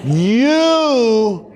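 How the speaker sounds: slow, drawn-out condescending teacher; teacher's condescending voice. Retold or imitated. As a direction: talk down to the new kid, slowly. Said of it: "she looked at me and she goes".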